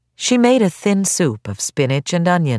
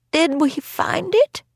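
put-on feverish old woman voice; 13 semitones higher, slower than usual.

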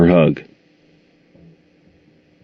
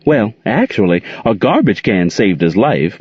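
usual speaking voice

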